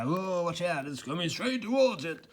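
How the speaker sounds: high, hammed-up warning cry; mock alarmed newsreader. Mocking the news report, hammed-up alarm. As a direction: ham up the danger like a TV presenter. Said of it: "implying, like, it's coming at you".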